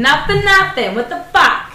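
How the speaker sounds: loud, high Gloucester-accented exclamation. Shown as a demonstration. Gloucester accent, showing off local slang to her cohost. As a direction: belt it out Gloucester style. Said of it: "island things".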